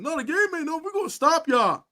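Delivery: defiant retort. Retold or imitated. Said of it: "I said".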